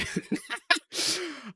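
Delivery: high, soft burst of laughter; self-mocking laugh. Real, not acted. Streamer jokes about a dumb American brain, self-mocking laugh. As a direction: laugh at your own joke.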